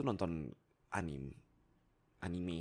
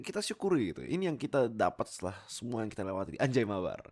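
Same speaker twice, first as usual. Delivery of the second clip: louder, higher hype; fired up; 5 semitones higher, 6 dB louder than usual. Real, not acted. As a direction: build up the excitement. Semangat memuncak. (semangat berapi-api)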